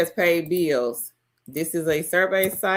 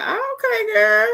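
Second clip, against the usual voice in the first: high, excited squeal; excited cheer; 4 dB louder, slower than usual. Real, not acted. Excited shoutout.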